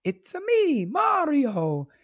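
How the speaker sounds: high video-game catchphrase impression; sing-song Italian plumber, "ah" in the name; Mario. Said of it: "He goes".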